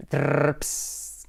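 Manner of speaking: rolled trill ending in a hiss; trilled sound effect. Real, not acted. Teacher teeing up the next modal, trill and hiss. Noise effect before explaining.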